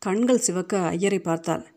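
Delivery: ordinary delivery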